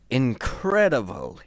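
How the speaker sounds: a touch of eager awe; enthusiastic. A narrator's reading. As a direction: say it with wonder. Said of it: "he enthused".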